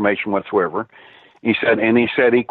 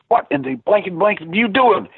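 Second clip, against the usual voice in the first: retold furious rant, much higher pitch; 10 semitones higher than usual. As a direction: imitate the angry webmaster yelling. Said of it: "He was furious".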